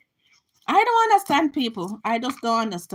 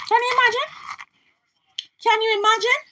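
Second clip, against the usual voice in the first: incredulous; 10 semitones higher, faster than usual. Real, not acted. Incredulous at the Florida law.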